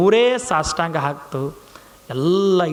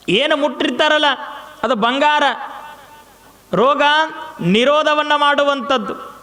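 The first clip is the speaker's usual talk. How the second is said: fervent preaching; 7 semitones higher than usual. Acted. Preacher extols guru's power.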